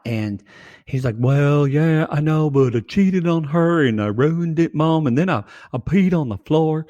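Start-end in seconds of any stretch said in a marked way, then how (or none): 1.2-6.9 whiny, higher dog voice confessing to Mom; golden retriever. Acted. Retriever owning up to Mama about cheating, whiny dog voice. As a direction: voice a sheepish pet dog confessing. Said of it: "that golden retriever's sacrifice".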